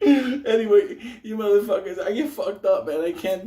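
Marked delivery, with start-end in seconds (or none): 0.0-1.0 laughing. Real, not acted. Dissolving into laughter.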